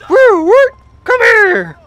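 high, loud whoops; excited whoop. Real, not acted. Hailing a taxi in the game with loud whoops. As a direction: whoop and wave the cab over.

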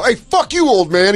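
high mock-angry heckle; acted heckling. Acting out a young comic cursing the old man, mock-angry.

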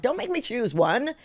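high-pitched mock plea; light, high-pitched pleading; playful protest. Acted plea to an interviewer.

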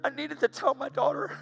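high, emotional pleading voice; choked-up remorse. Real, not acted. Father needing to apologize to his daughter, choked up. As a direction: push it out high, close to tears.

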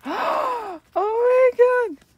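real thrill at the pull; excited gasp. Real, not acted. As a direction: gasp then a slow, high, loud oh my God. Sees the photocard she pulled.

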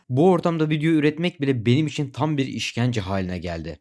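ordinary talk

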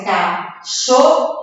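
"Cachorro" slowly in three syllables, ca, chor, ro; teacher's slow model of "Cachorro"; demonstrated pronunciation. Shown as a demonstration. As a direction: sound out "Cachorro" with strong double R. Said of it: "olha a pronúncia".

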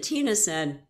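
usual speaking voice

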